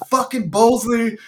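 high-pitched mock exasperated griping; griping outburst. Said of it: "I start bitching".

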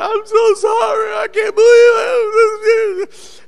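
high, loud, exaggerated wailing apology; imitated crying. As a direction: wail it slowly, mimicking a sobbing driver. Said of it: "She's crying".

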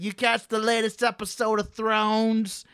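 put-on high old-lady Boston accent; put-on Boston accent, high-voiced old woman.

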